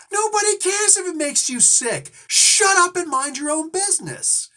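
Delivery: very high, loud shriek; retold screaming. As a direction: imitate a furious wife yelling down a stranger. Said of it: "had to go up and scream at her".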